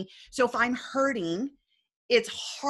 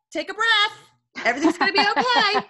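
put-on seven-year-old son; 12 semitones higher, 7 dB louder than usual. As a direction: imitate a child calling out reassurance. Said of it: "He is the one that says".

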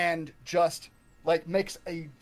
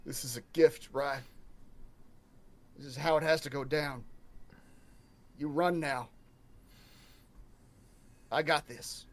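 low, soft, with long pauses; grave, quiet farewell; 4 semitones lower, 6 dB quieter than usual. Acted. Pale Sham telling Rye to run, quiet and grave. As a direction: say it softly, resigned to staying behind. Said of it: "very pale, shaking".

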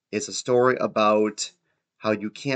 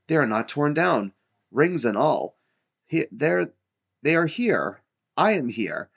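higher, mildly raised exclamations; crying out; 7 semitones higher, 3 dB louder than usual. A narrator's reading. Scrooge clutching his bed curtains, crying out in excitement. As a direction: exclaim it in mounting relief. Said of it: "cried Scrooge".

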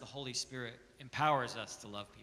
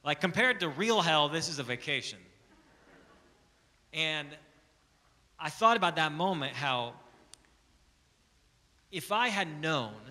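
loud, rising, impassioned preaching; preaching at full force; 8 dB louder than usual. Acted. Pastor urging his church to share the gospel, preaching at full force. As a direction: drive it home to the congregation.

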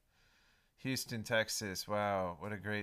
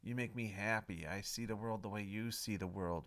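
normal talking voice

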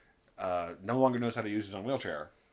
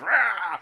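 mock zombie roar; 9 semitones higher, 9 dB louder than usual.

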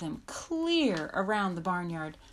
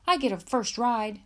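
put-on louder, higher boyish demand; insistent claim; 5 semitones higher, 6 dB louder than usual. A narrator's reading. Pierre demands the first ride, insistent. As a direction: claim it as yours.

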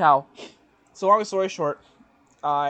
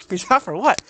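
incredulous; 5 semitones higher, 9 dB louder than usual. Retold or imitated. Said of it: "I'm like".

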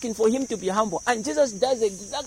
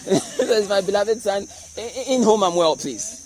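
mock divine announcement. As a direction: proclaim it like a booming public announcement from heaven. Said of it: "Announcement from Jehovah".

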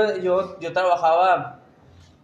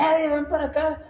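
mock yell, pitched way up; retold angry calling out; 10 semitones higher than usual. Angry mother summons her son Eddie, high-pitched mock yell. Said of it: "y empezó a gritar".

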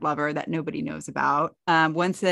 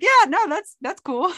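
high, louder, fake-agreeable brush-off; mock polite approval; 13 semitones higher, 5 dB louder than usual. To the mom who smokes in the treehouse, a high fake-cool reply. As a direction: pretend to be fine with it while clearly not.

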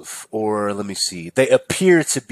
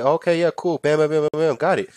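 brisk imitation, pitched up; higher, businesslike patter; short, sweet, blunt; 7 semitones higher than usual. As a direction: rattle it off like a no-nonsense cashier. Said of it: "she was short, sweet, blunt".

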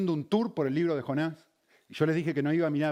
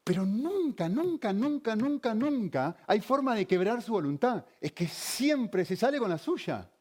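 pitched up, exasperated at a stubborn person; mock complaint, higher, exasperated; 7 semitones higher, slower than usual. Mimicking what you say of the stubborn one, exasperated. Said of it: "Que decís".

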